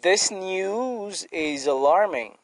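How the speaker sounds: This news is alarming, said slowly word by word; slow example sentence. Shown as a demonstration. Teacher reading an English example to the class, slow and deliberate. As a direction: read it out slowly so students catch it. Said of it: "Par exemple, je dis".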